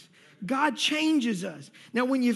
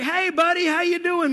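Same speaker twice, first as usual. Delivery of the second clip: hearty coworker greeting; 7 semitones higher, 6 dB louder than usual. Retold or imitated. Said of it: "they're like".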